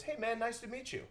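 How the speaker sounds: high-pitched friendly greeting; imitating an easygoing billionaire, voice lifted way up; put-on high, chummy hello; Jeff Bezos. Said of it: "And he goes".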